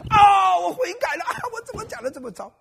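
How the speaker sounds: mock self-repentance. Preacher jokingly pierces his own heart with a mock wail. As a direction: play a preacher stung by his own sermon, overdone. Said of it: "我自己扎自己可以吧".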